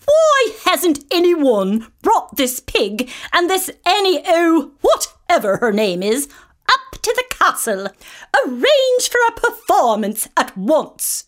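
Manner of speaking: a spoiled king ordering his servants; commanding high-handed king; cruel king. Acted.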